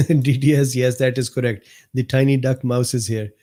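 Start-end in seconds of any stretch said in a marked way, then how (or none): none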